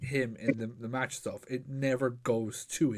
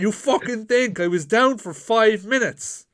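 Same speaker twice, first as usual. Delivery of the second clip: higher and louder, retold indignant comeback; indignant retort; 7 semitones higher, 7 dB louder than usual. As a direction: throw it back at him, half laughing, half fuming. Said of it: "And I was like".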